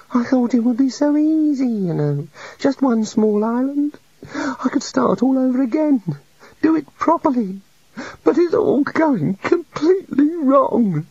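high meek little god. A narrator's reading. The little god confesses to the wizards, meek and high. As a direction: play a shy, sorry deity.

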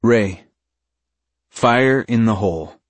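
ordinary delivery

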